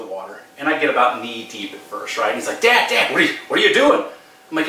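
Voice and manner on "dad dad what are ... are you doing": high-pitched imitation of a worried son; alarmed child. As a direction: call to dad in mock alarm. Voicing his scared child.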